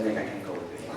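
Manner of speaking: low, very quiet bad news; hushed and dropped low; letdown. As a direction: quote a deflating cancellation under the breath. Girl cancels once more, retold.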